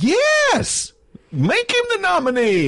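very high, drawn-out gleeful whoop; mock elated cheer. Acts out past cheering for nomination.

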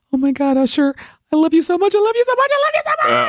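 a starstruck fan losing it, climbing to a squeal; gushing fan. Retold or imitated. Very nervously walks up to him.

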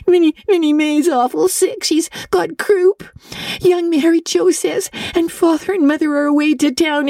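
high, breathless and tearful, slowing to a sob at the end; a scared child blurting out bad news; frightened girl. Diana panics, sister sick.

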